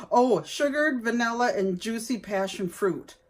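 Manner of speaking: ordinary delivery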